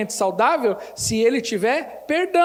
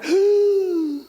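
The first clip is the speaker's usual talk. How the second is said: mock shocked gasp; 7 semitones higher, 4 dB louder than usual.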